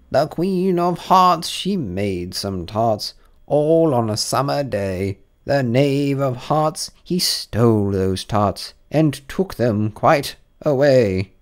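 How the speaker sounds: high, loud, slow herald's verse; the Rabbit proclaiming the charge in rhyme; read as proclamation. A narrator's reading. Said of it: "read as follows".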